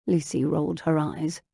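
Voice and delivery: usual speaking voice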